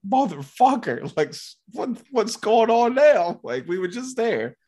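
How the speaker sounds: mock fed-up outburst; exasperated outburst. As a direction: imitate the cousin blowing up. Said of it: "throwing his hat".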